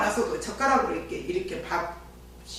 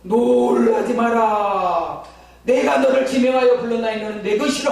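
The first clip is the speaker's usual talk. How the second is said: voice of God; 4 dB louder than usual. Retold or imitated. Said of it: "막 이러는 거예요".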